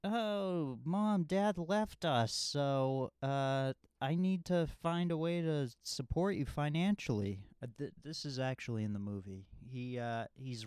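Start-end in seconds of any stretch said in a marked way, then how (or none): 0.0-7.4 put-on earnest little boy. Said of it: "and he's like".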